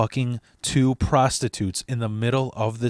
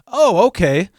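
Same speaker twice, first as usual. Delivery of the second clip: acted realization; 8 semitones higher, 7 dB louder than usual. Cashier accepts the dropped names.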